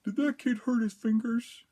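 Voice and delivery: high, mocking voice of a late security guard. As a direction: squeak it out like a clueless guard. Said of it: "he's all like".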